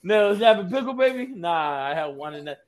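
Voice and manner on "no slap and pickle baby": louder and higher, hamming it up; louder, higher flirty correction of a friend; playful correction. Pretend. Correcting the phrase, acting it.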